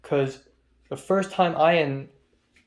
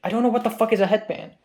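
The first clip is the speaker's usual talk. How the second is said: fast baffled rant; 6 semitones higher, 4 dB louder, much faster than usual. Real, not acted. Baffled by unknown game.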